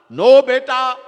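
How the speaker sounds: high, loud mock parent's voice; a hint of an old mother saying no; parent refusing. Parents refuse their son.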